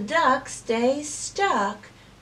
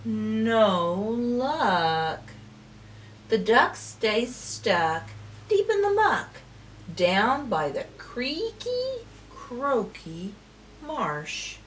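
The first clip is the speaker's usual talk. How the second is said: plain reading voice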